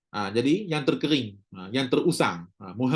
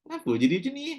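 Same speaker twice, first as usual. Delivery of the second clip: mock puzzlement; 12 semitones higher, much faster than usual. Puzzled reaction voiced.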